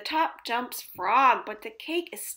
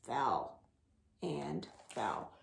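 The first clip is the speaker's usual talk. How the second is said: much lower, much softer; sinking fall; 10 semitones lower, 8 dB quieter, slower than usual. A narrator's reading. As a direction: let it sink away. Falling lower and quieter.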